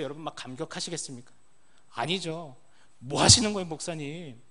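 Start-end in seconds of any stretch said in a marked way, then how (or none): 3.0-4.4 a loud, startled cry of protest; shocked protest. Pretend. Patient startled by the pastor's odd touch, loud protest. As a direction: blurt it out at the pastor, taken aback.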